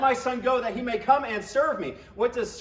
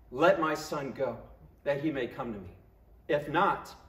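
God as father; 7 semitones lower, 3 dB quieter than usual. Acted. God speaking to Pharaoh as father.

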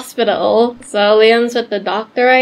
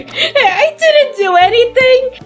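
very high, squeaky protest; cartoonish high-pitched defensiveness; indignant denial; 12 semitones higher than usual. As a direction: squeal your innocence. Cherry denies the blame.

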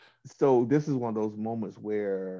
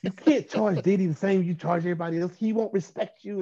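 higher-pitched imitation of an insistent girlfriend; insistent arguing; 10 semitones higher, 3 dB louder than usual. Girlfriend pushing coach over what to charge, insistent. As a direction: argue it urgently, pitched up, as retold. Girlfriend's retold side of money argument.

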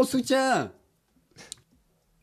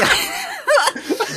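high laugh. Real, not acted. Laugh burst.

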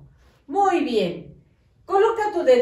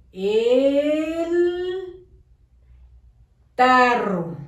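El, a long pause, then tarro louder; loud dictation; 3 semitones higher, 3 dB louder, much slower than usual. Shown as a demonstration. Teacher dictating to pupils, loud and with a pause. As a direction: call out El, wait, then hit tarro loud.

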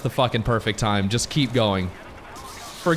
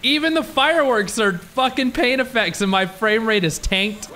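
ordinary talk

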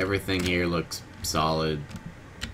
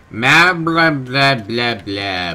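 slow, loud, higher nonsense chatter; mock shopkeeper babble; 5 semitones higher, 8 dB louder, much slower than usual. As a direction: ham up a merchant's patter.

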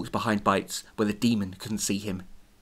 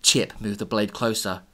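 ordinary delivery

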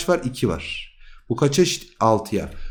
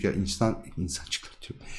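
breathy whisper, much softer, calling the little humans in; 6 semitones lower, 11 dB quieter than usual. Pretend. Teacher whispers to students to gather round. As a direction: hush it like a secret summons.

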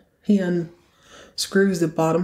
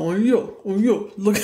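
mocking a dude; 2 semitones higher than usual. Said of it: "making fun of a dude".

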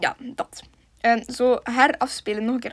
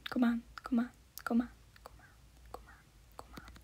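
whisper; 12 dB quieter than usual. Real, not acted. Aanmoedigend fluisteren.

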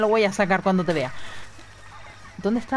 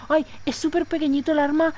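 high, prissy mock voice for small-gun fans; 7 semitones higher than usual. Streamer mocking fans of small guns to Terra, high mock voice. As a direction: lampoon people who love tiny weapons, pitched up. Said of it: "se lo dije a Terra en plan".

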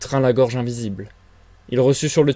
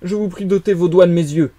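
loud, higher-pitched fierce request; fierce plea; 6 semitones higher, 6 dB louder than usual. A narrator's reading. Invisible man asking for fingers out of his eyes, fiercely pleading. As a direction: plead forcefully, with an edge. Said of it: "du ton d'une prière farouche".

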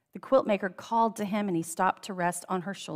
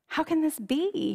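much higher, slow cry of wonder; amazed cry; 8 semitones higher than usual. A narrator's reading. King crying out in amazement at his own happiness. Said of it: "he cried".

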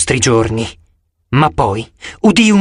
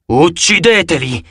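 slow, monstrous growl; 3 semitones higher than usual. A narrator's reading. A monster ordering the army to kill them, growling. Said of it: "ringhiò".

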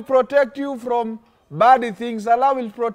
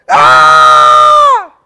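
loud high terrified scream; 8 semitones higher, 14 dB louder than usual. Acted. Teacher acting the dreamer's fright for the class, loud high scream. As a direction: shriek as the lion leaps.

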